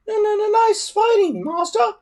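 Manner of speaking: very high squeaky dragon voice; little dragon voice. A narrator's reading. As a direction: chirp it like a tiny dragon. Toothless the dragon speaks.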